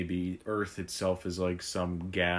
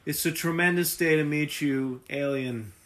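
Trump impression; 6 semitones higher, 3 dB louder than usual.

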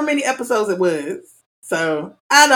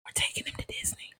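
whispering; 5 semitones lower, 20 dB quieter than usual. Real, not acted. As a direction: breathe the words without voice. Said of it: "I have to whisper this part".

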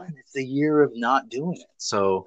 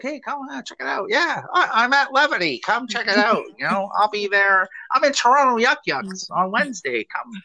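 hustler voice; 11 semitones higher than usual. Shown as a demonstration. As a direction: hype people up to come to the show. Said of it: "that's my hustler voice".

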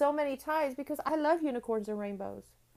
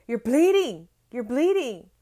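dad exclaiming; 7 dB louder than usual. Retold or imitated. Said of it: "And he'd be like".

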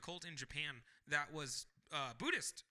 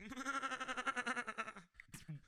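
high-pitched villainous giggling fit; maniacal giggle; 6 semitones higher, 4 dB quieter than usual. Acted. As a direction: giggle like a crazed little killer. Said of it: "like, giggling maniacally".

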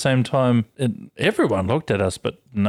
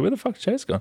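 surprised; 10 semitones higher, 4 dB quieter than usual. Retold or imitated. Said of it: "I was like".

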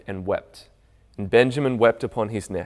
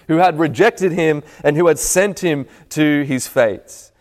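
higher, louder preaching build; rising preaching; 7 semitones higher, 5 dB louder than usual. Acted. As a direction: drive it home like a climax.

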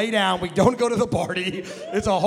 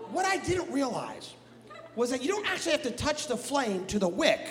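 ordinary talk, nothing marked

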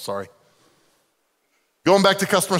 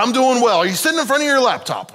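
raised, louder preacher voice; pastor voice; 9 semitones higher, 5 dB louder than usual. Retold or imitated. Ministry caller pitching a pastor, mock pastor voice. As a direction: put on the smooth pastor voice, pitched up. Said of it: "pastor voice going".

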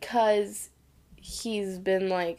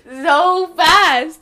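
much higher and louder, slow, laughing through it; laughing disbelief; 8 semitones higher, 11 dB louder, slower than usual. Real, not acted. Host reacting to Tom giving himself up, laughing hard. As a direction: crack up in disbelief at his quick surrender. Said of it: "And I'm like".

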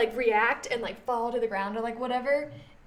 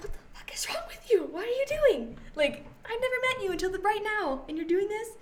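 high, shocked, half-whispered disbelief; a retold panic, pitched up and rushed; freaked-out outburst; 9 semitones higher than usual. Retelling a creep in acting class.

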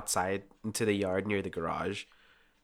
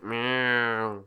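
long, drawn-out imitation meow; cat meow; 4 dB louder than usual. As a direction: imitate a cat complaining at being held. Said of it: "And he's like".

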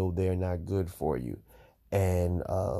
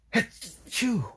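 sneeze; 13 semitones higher than usual. Real, not acted. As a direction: sneeze hard, then recover. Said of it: "Excuse me again".

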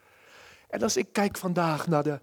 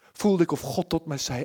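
usual speaking voice

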